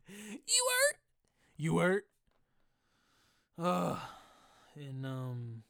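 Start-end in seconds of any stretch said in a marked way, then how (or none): 0.1-1.0 very high squeaky rising question; squeaky mock question. Podcaster squeaks a mock question way up high. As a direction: squeak it like a cartoon.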